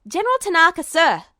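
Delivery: loud, urgent call to a superior. Acted. Edward calling out to General Tanaka, loud and urgent. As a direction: get the general's attention now.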